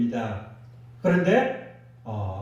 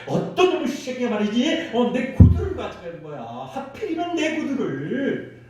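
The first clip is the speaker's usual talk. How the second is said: cursing the shoe thief in mock anger; annoyed rant about the stolen shoes; 6 semitones higher than usual. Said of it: "막 아주 욕이 나온 거예요".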